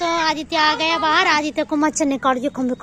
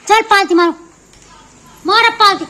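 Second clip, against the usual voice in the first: higher, louder scolding order; 4 semitones higher, 6 dB louder than usual. Real, not acted. Mother scolding child to sit cross-legged, firm order.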